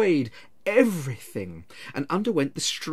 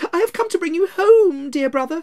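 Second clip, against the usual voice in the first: put-on high, loud girlish voice; little girl; 8 dB louder than usual. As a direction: play an eager little sister. Little Fan voiced by narrator.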